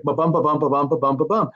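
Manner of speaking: steady ba-bum pulse marking the meter; tapping out the iambs; iambic beat. Shown as a demonstration. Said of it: "but it was iambic".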